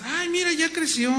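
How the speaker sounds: light mock tenderness to wife; playful sweet tone. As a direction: coo it like a playful compliment. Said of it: "le dices".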